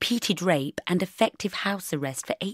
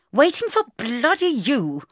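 mildly raised, tight irritation; through clenched teeth; 7 semitones higher, 4 dB louder, slower than usual. Acted. Bridget answering Mark's question, through clenched teeth. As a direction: force it out through gritted teeth. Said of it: "I said between clenched teeth".

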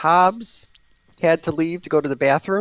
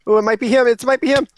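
excited; 9 semitones higher, 6 dB louder, faster than usual. Real, not acted. Suspecting the colorful guy.

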